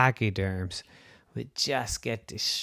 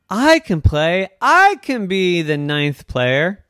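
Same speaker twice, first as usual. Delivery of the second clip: usual speaking voice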